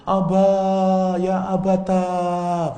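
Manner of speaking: a high, loud, sung-out lament; drawn-out keening lament; mournful wail. Shown as a demonstration. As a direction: wail "ya abata" like crying for a father. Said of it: "menunjukkan tangisan, penyesalan, sedih".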